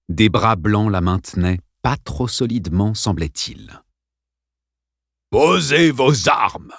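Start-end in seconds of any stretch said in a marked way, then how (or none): 5.3-6.7 slow, imperious order; commanding principal. Acted. Principal orders the children, slow and imperious. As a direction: crack it like a whip. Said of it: "d'une voix aussi impérative qu'un claquement de fouet".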